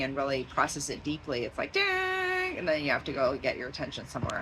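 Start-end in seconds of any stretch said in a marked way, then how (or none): 1.7-2.6 high, long ringing "ding"; high, sustained notification noise; imitated ding. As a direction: sing out a ding like an alert bell. Imitates attention being interrupted.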